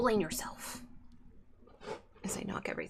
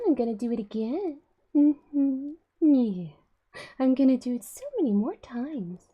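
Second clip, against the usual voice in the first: high, loud cheeky cat voice; voicing the cat; 6 semitones higher, 11 dB louder than usual. Voicing the cat's fake apology to the viewers, high and cheeky. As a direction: speak as the guilty but unrepentant cat.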